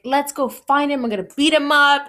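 mildly angry, rushed outburst; retold fury. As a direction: imitate a friend fired up for a fight. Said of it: "she was so furious".

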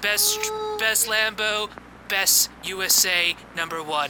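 high, soft Trump voice; squeaky mock Trump boast; Donald Trump impression. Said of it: "we got a clip of that".

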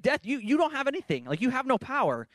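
high-pitched mocking taunt; Paul. Preacher voices the apostle taunting death, sarcastic and high. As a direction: scoff at a powerless foe, pitched right up. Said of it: "super sarcastic".

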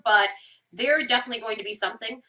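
ordinary talk

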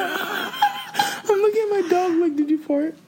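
high, helpless real laughter through words; laughing. Real, not acted. Owner asking the dog if it farted, laughing. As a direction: squeeze the question out through giggles.

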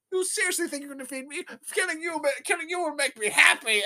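high-pitched cartoon villain gloat; Spider-Man. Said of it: "He's like".

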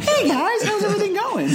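very high, squealing cartoon greeting; hammed-up high cartoon voice with laughter; clown-wigged cartoon character. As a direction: imitate a chirpy clown in falsetto. Acts out clown-wig SpongeBob arriving.

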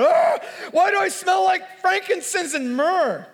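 mock panicked yelp, high and drawn out; hammed-up claustrophobic panic; freaking out. Lazarus wakes bound in the dark cave, comically freaking out. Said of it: "he was freaking out".

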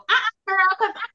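high, sassy brush-off; sassy "uh-uh, girl". Retold or imitated. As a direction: toss it off with big attitude. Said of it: "get the whole".

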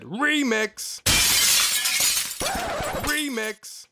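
DJ hype shout. Acted. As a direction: shout it like a DJ drop. Hype DJ call out.

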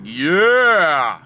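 loud, high whoop of joy; loud rising celebratory yeah; excited yell. Retold or imitated. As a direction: cheer like your team just won. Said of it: "and I'm like".

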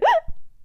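sharp high hiccup gasp. Acted. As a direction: squeak out a hiccup. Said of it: "j'ai le hoquet". (hoquet aigu)

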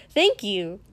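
pleased and proud; bright happy thanks; cheerful. Acted. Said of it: "Denki said cheerfully".

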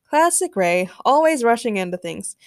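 ordinary delivery

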